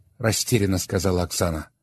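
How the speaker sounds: plain reading voice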